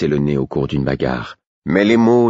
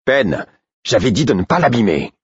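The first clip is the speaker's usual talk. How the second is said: angry rebuke; 5 semitones higher than usual. A narrator's reading. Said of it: "fit Royal avec colère".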